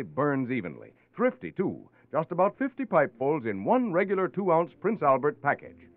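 ordinary delivery

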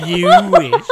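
high shrieked mock line; squealing quote.

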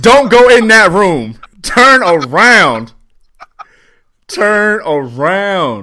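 loud, high mock-horror warning; yelling at the screen. Horror-movie viewer warning the character, yelling. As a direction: shout at the movie character, drawing out the last words. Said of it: "people are yelling at the screen like".

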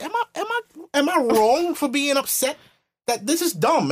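high-pitched plea of disbelief; re-enacted high, incredulous protest; indignant protest. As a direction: protest as if wrongly blamed.

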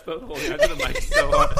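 high shrieking laughter; laughing hysterically. Real, not acted. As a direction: laugh through the words. Chaos, laughing hard.

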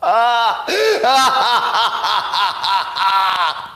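big, high forced laugh; loud mock cackle. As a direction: fake hearty laughter at a lame joke. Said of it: "mock laughter at joke".

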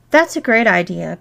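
ordinary delivery

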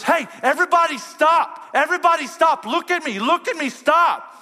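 high, loud command that stops everyone cold; commanding older daughter. Retold or imitated. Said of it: "says it in such a commanding way".

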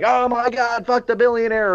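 high, mock-indignant voice; outraged social media poster. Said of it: "social media where they're like".

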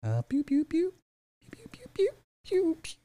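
high mock laser shots, pew pew; playful high-pitched shooting sounds; pew pew gun noises. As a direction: fire off high toy-gun pews.

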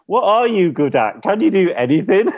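high teasing voice scolding himself; jeering at his own failings; mocking self-scold. Mocks himself as a scolding critic.

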